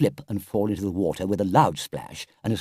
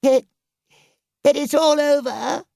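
high, loud wailing despair; sobbing Toad; 6 dB louder than usual. A narrator's reading. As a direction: wail it like a tearful, melodramatic Toad. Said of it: "sobbed the Toad".

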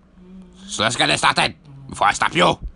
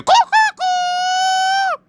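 shrieked very high and slow; squealing battle cry; much slower than usual. Acted. Master shrieking the technique name at his student.

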